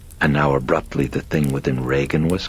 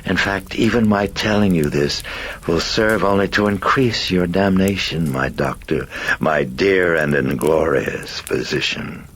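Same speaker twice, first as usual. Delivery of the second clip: exultant demon, raised pitch settling at the end; grinning demon; 5 semitones higher, 3 dB louder than usual. Acted. Demon gloats over the priest's damnation, grinning and exultant. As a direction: revel in it with a demon's grin.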